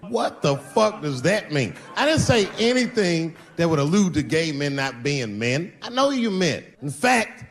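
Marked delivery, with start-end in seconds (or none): none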